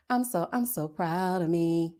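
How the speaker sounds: soft, slow repeat to herself; quiet and proud. Real, not acted. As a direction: say it softly, savoring it.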